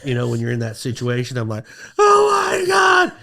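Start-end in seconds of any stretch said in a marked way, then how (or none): 2.0-3.1 loud high wail, drawn out; hammed-up panicked cry. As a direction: re-enact a sobbing scream. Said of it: "I'm crying".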